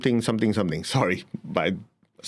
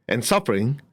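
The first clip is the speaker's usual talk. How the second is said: usual speaking voice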